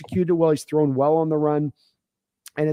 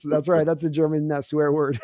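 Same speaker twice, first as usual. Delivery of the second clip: ordinary delivery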